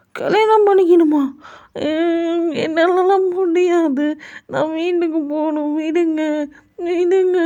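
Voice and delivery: put-on very high, whiny little-boy voice; shocked childlike whine. Jungkook refusing marriage to the mother, very high childlike whine.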